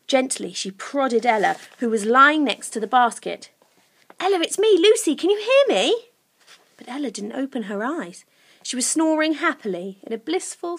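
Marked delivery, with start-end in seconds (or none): 4.2-6.1 put-on high, urgent girl's voice; urgent Lucy. Lucy prodding sleeping Ella, urgently calling. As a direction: try to rouse her, high and loud.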